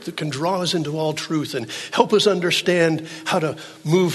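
high, loud, impassioned sermon delivery; full-force preaching. Acted. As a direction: drive it home to the congregation.

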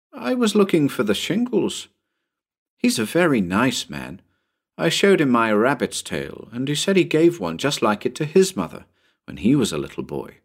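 put-on high-starting child's explanation; earnest little boy. Tommy tells about the nice man, earnest boy voice. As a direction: chatter innocently like a small boy.

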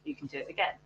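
the soft little voice in your head; inner voice. Acted. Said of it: "that little voice in the back of your head".

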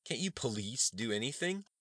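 high, slow, contemptuous demand; disrespectful exclamation. A narrator's reading. Student leader taunting the police, openly disrespectful. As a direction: spit out "police" like an insult. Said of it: "exclaimed disrespectfully".

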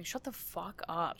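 muttering. Retold or imitated. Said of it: "I found myself muttering".

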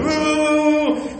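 high drawn-out "woo" noises; imitated commotion. Storyteller imitating arguing robbers, high whooping. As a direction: mimic a wild scuffle with high whoops.

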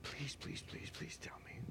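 soft, begging whisper; whispered pleading. Real, not acted.

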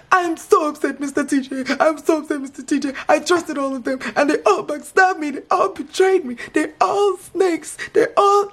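high, wailing mock sobs; Emmy crying meltdown. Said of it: "crying, melting down just like she did".

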